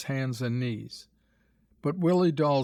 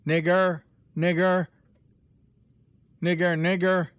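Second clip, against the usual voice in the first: loud, much higher jeering yell; a little boy's mocking call; called-out taunt; 5 semitones higher, 6 dB louder than usual. A narrator's reading. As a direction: shout the taunt from a safe distance. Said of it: "called out".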